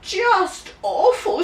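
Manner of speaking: put-on high, slow, pitiful little-boy voice; small boy feeling sorry for himself; whimpering child. A narrator's reading. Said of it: "said James".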